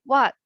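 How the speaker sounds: "What?" with a short falling fourth tone; a mild falling "What?"; angry falling What. Shown as a demonstration. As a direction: drop "What?" down like you're annoyed. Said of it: "Like when you're angry".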